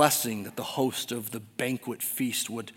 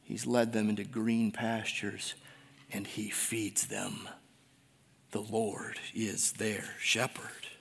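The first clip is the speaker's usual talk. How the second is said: softer and slow, tender and sincere; tender hush; 5 semitones lower, 9 dB quieter than usual. Real, not acted. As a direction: lay it down gently, slowly. Tender slowing climax.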